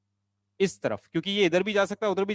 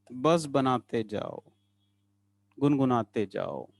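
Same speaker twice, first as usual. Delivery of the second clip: low, slow rhyming singsong; drawn-out coaxing; 4 semitones lower, slower than usual. Real, not acted. Teacher telling students to hum along as they draw, low slow singsong. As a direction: lilt it like a slow refrain. Teacher chanting playful rhyme to class.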